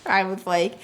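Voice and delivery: ordinary talk, nothing marked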